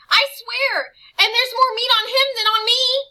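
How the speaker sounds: high, loud desperate insistence; boy selling out his friend; frightened pleading. Acted. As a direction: swear it in panic.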